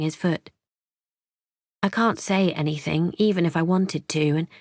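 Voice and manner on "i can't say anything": plain reading voice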